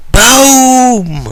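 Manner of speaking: a shouted high boom, drawn out; loud high boom. Acted. Narrator shouting boom to mark a sudden entrance. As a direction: blast the boom like an explosion. Sound effect in a silly story.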